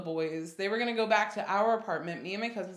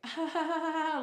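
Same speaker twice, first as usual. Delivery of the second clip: freaking-out laugh; 8 semitones higher than usual. Retold or imitated. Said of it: "freaking out".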